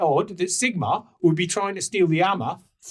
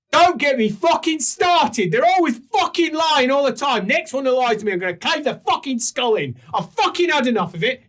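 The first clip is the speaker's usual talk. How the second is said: furious dwarf rant; 9 semitones higher, 5 dB louder than usual. Acted. Angry dwarf ranting to the group about lying humans. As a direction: spit out the fury, each curse hammered. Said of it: "She got so mad that her accent changed".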